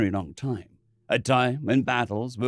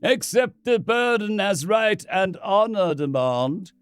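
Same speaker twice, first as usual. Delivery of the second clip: solemn priest; 7 semitones higher, slower than usual. Acted.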